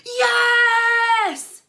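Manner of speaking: loud, high, long cry of joy; triumphant shout. A narrator's reading. Crowd cheering the winning goal. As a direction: roar it in victory. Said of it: "shouted the crowd".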